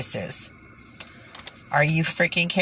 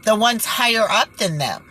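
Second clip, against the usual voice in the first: ordinary talk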